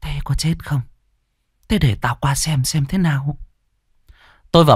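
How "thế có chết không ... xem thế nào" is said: a touch higher and softer; mild anxious old woman; worried grandma. Acted. Grandma hears the boy is sick, softly worried. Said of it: "Bà nội lo lắng".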